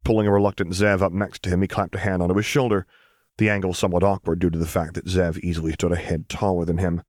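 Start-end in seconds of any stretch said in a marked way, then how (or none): none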